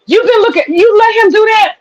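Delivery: high, disbelieving outrage; can't believe she let him; outraged disbelief. Retold or imitated. Retelling her reaction to Beth, high incredulous outrage. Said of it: "I said".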